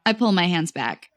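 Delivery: plain reading voice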